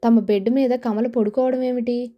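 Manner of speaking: ordinary delivery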